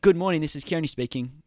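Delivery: phone greeting. Shown as a demonstration. Answering a business call, brisk polished phone greeting. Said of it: "that vocal tone".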